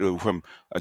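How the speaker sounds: normal talking voice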